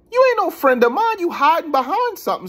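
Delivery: high, loud, heated callout; acted-out angry confrontation; indignant accusation. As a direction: call out the liar, voice way up. Retelling his accusation of the stranger.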